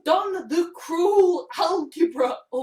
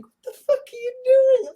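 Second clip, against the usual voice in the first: high, loud, fast inner protest; alarmed inner voice; 11 semitones higher, 7 dB louder, faster than usual. Retold or imitated. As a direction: burst out as the panicking voice in your head. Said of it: "little part, my mind is saying".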